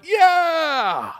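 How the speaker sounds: high, loud whooping yeah; crowd cheer. Acted. Writers cheering the raise, loud high yell. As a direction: cheer like a stadium. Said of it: "they all went".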